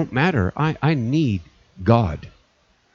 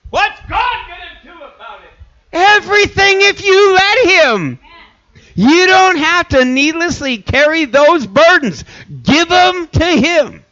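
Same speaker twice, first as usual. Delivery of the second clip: high, ringing sermon call; soaring preacher's voice; high preaching cry; 13 semitones higher, 3 dB louder than usual. Acted. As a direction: cry it out to the congregation.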